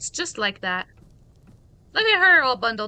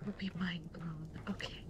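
whisper; 5 semitones lower, 18 dB quieter than usual. Real, not acted. Sneaking through creepy house.

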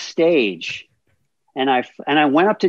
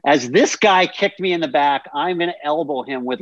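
ordinary talk, nothing marked

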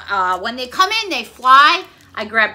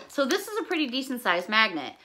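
normal talking voice

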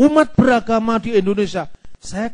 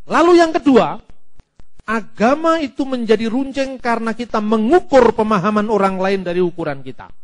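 usual speaking voice

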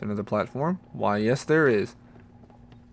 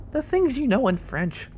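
high-pitched line with a crack in it; squeaky, cracking voice; 10 semitones higher than usual. Real, not acted. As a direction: let the voice break up high. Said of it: "Wow, my voice cracked there".

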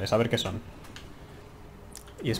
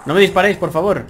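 loud, higher pleading cry; 5 semitones higher, 6 dB louder than usual. Real, not acted. Player begs enemies not to shoot, loud pleading. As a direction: beg them not to shoot.

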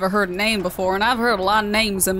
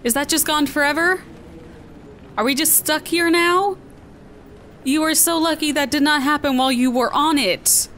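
alarmed scolding; 6 semitones higher than usual. Real, not acted. Scolding after the stunt goes wrong.

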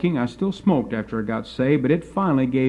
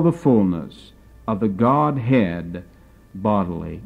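slow, measured scripture quote; solemn scripture reading; slower than usual. Acted. Preacher quoting Paul to the congregation, slow and solemn. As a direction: read the verse with weight.

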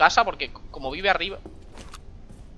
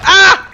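short, high, loud yelp; startled scream; 13 semitones higher, 13 dB louder than usual. Real, not acted. Player startled by a door opening, startled scream. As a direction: yelp as if jumped.

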